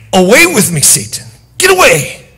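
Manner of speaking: high, loud banishing shout; commanding rebuke. Acted. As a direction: cast the devil out. God rebuking Satan.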